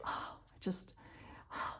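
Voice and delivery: breathless whisper over the lace; soft awestruck whisper to herself; whispered awe. Real, not acted. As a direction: whisper it like you can't believe it. Admiring lace, hushed aside.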